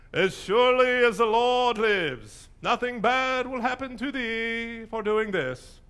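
high, slow, loud proclamation; mock-solemn oath in a grandiose put-on voice; Saul. King swearing an oath to the witch, grandiose proclaiming. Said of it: "he always speaks like that".

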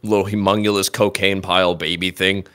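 low, quiet creature mumble; sentient cocaine pile. Acted. Neglected cocaine pile complaining, low quiet mumble. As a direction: grumble it low like a sad pile.

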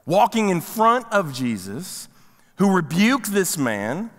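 ordinary talk, nothing marked

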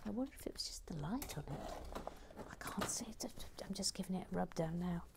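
hushed aside to herself; whispering. Real, not acted. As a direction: mutter it under your breath. Muttering to self while cleaning.

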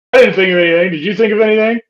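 retold flustered team; mock panicked checking. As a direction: check on each other in mock alarm. Quoting heroes panicking at each other.

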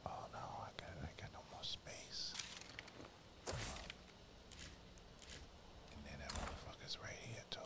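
hushed whisper. Real, not acted. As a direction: whisper while sneaking. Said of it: "I don't know why I'm whispering".

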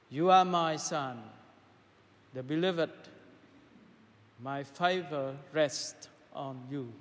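ordinary talk

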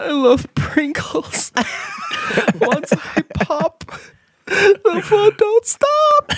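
very high, slow squeaky snack-lover voice; binge eater. Acted. Voicing a binge eater quoting the Pringles ad, very high and slow. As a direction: squeal the jingle like a glutton.

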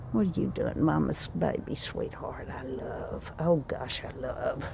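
very soft pet-talk, fading to near whisper; real hushed doting; tender cooing. Real, not acted. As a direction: coo adoringly to your cat, barely voiced. Cooing to her pet cat.

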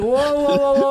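high, loud run of mock alarm; retold high shout to halt things; alarmed calming call. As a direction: holler to break up a fight, imitated. Retold stepping in to calm.

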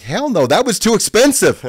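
loud, high emphatic no; emphatic refusal. Retold or imitated. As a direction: bark the refusal for laughs. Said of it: "I'm like".

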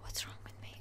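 embarrassed whisper to herself. Real, not acted. Player muttering at herself after the silly accent, whispering. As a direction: hush it, mildly sheepish.

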